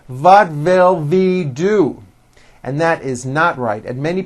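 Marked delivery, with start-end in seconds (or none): 0.1-2.0 "What will we do?" with every W turned into a slow V; German accent. Retold or imitated. Teacher mimicking a foreign speaker's V for W. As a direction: imitate a German speaker saying vat vill vee. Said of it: "they may say".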